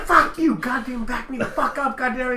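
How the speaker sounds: high, loud, laughing yell; mock angry yell. Husband retelling yelling at his wife, laughing. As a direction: bark at her to back you up. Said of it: "I'm like".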